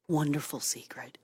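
low, hushed, secretive near-whisper. Acted. Host reading a note's sign-off to a pal, hushed. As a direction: drop it soft and low, like a secret.

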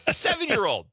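high, loud disbelief; incredulous. Real, not acted. Host reacting to a small-town kid's shotgun story, incredulous. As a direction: exclaim it in amused amazement. Amazed at child with loaded shotgun.

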